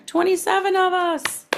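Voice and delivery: high-pitched, thrilled exclamation; real burst of happy excitement; excited delight. Real, not acted. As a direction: marvel joyfully at the turnout, voice leaping up. Delighted at how many came.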